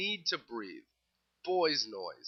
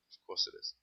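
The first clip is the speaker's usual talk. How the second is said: sarcastic aside; 8 dB quieter, faster than usual. Real, not acted. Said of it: "sarcastic aside on beer sponsor".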